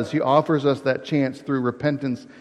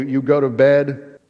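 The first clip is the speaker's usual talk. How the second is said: normal talking voice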